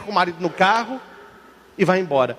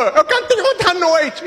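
put-on slow, high childish plea; high little boy; 8 semitones higher than usual. Altar boy tells his mom he wants to sing, high child voice. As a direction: stretch it out like a small kid.

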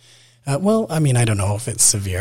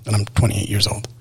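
ordinary talk, nothing marked

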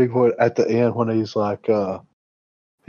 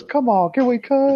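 very high, exasperated mock plea; retold pleading; 13 semitones higher than usual. Struggling actor begging to cut the take, pleading. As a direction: imitate an actor desperate to stop. Actor begs again to stop.

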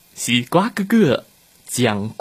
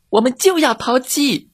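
high, defiant chick voice; protesting chicks; 12 semitones higher than usual. Acted. Chicks protesting to the dog teacher, high and defiant. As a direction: squeak it back defiantly. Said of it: "小鸡们抗议了".

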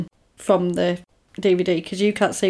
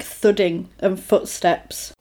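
ordinary talk

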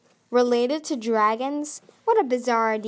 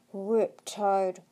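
growled name; 2 semitones lower than usual. A narrator's reading. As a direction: growl it, threatening. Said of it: "growled the dragon".

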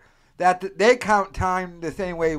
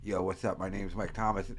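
low, softer American actor voice; laid-back mock American audition voice, pitched down; American accent; 8 semitones lower, 9 dB quieter than usual. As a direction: imitate a Brit doing a casual American accent. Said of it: "sounds exactly like he's American".

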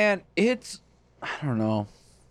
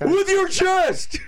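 high, drawn-out yell; long, high catchphrase shout; echoed shout; 13 semitones higher, much slower than usual. Acted. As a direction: stretch it into a slow holler.

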